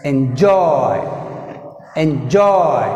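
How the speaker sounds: "enjoy" with a long, strong JOY; en-JOY, "enjoy" drilled for stress; stress on second syllable. Shown as a demonstration. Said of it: "Joy is second syllable".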